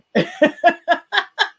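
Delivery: real rising laugh, high and loud; delighted laughter. Real, not acted. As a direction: burst out laughing at the message. Host laughs at guest's message.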